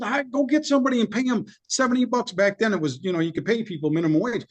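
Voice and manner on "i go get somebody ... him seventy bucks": exasperated order. Retold or imitated. Ordering son to hire a cleaner.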